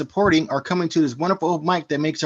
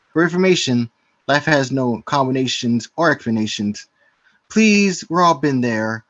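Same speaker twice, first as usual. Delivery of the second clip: poem recitation; 4 semitones lower, slower than usual. Acted. Recites his rhyming poem.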